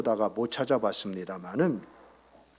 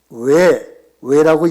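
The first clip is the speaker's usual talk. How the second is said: loud, punchy Seoul-style "왜"; Seoul parent answering with a loud, sharp "왜라고"; strong accent; 3 semitones higher, 11 dB louder, much slower than usual. Retold or imitated. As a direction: hit each "왜" hard and loud. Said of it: "조금 액센트가 강해요".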